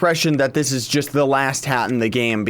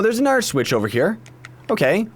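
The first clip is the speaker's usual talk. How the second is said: usual speaking voice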